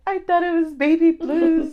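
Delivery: high, loud self-mocking whine; mock protest. Patient answering doctor's diagnosis, mock protest. Acting her reply to doctor.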